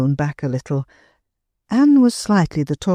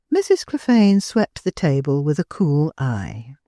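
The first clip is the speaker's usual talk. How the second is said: ordinary delivery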